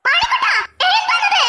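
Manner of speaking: high, startled demand; shocked question. Acted. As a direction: catch him out in disbelief. Woman shocked at her husband.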